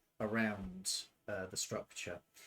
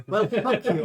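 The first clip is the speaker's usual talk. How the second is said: loud, higher, joking indignation; mock outrage; 8 semitones higher, 14 dB louder than usual. Real, not acted. Game master loudly mock-offended at the player teasing. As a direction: snap back loudly, all in fun.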